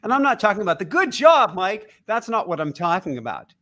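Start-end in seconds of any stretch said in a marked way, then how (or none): 0.8-1.9 high, loud mock pat on the back; smug ego praise. Imitating ego congratulating Mike, higher and louder. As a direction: mimic a self-satisfied inner voice. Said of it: "Ego is always gonna come to you in a voice of".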